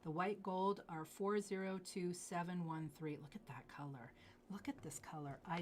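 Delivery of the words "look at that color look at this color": whispered admiration; awed whisper. Real, not acted. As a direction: breathe it like a secret. Marveling at jade color.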